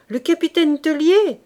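shouted name. Acted. Soldier shouting the captain's name to Fabrice over the guns, loud. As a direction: yell le capitaine Tellier? as if over cannon fire.

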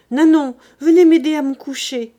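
high, loud plaintive call; wailing invalid's plea; ailing mother. A narrator's reading. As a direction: call for help in distress. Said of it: "dit la mère d'une voix faible".

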